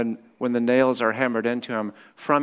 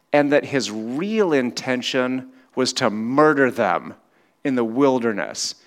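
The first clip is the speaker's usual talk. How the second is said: usual speaking voice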